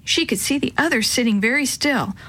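ordinary delivery, nothing marked

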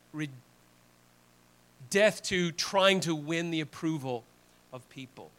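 usual speaking voice

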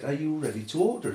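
a touch of Cockney on a waitress's question; imitated Cockney accent. Waitress asking the family for their order, in mock Cockney. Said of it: "Cockney accent".

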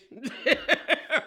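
loud high cackle; high laugh. Real, not acted. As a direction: burst out laughing. Host laughs after "a little pissed.

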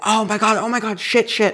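high, frantic oh my God and shit; sudden panic at the airport kiosk; panicked cursing. Retold or imitated. Panicked realizing she forgot something.

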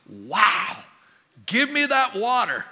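loud, emphatic burst of amazed eagerness; big wow, then a high, drawn-out demand; excited. Acted. Preacher voicing the woman at the well to his congregation, excited. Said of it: "I think she was excited".

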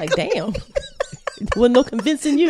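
laughing while teasing. Real, not acted. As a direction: giggle through the words. Teasing friend about early vaccine.

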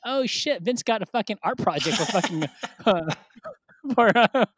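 high-pitched jeer breaking into laughter; mocking classmates. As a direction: imitate kids pointing out a laughable gift.

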